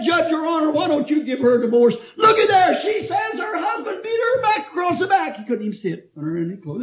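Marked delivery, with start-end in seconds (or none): 0.0-5.4 pleading lawyer. Retold or imitated.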